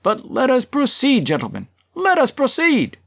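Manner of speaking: high, insistent call to order; chairman's high-pitched shout; shouting over uproar. A narrator's reading. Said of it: "kept shouting".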